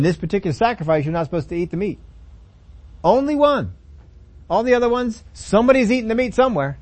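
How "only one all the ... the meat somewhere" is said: much higher and louder, excited; emphatic. Real, not acted. Preacher stressing the one exception to his listeners, excited. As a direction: lift the voice high to drive it home.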